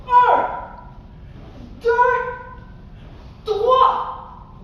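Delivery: high, loud count with long gaps; shouted count. Shown as a demonstration. Teacher counting stomps with the other leg, shouted. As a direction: punch out each number with a stomp. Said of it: "on en fait trois".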